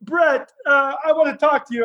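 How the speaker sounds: barge in hamming up the Sheik; a lightly shouted Sheik bursting in; storming Sheik. Retold or imitated. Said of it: "blows the door up and goes".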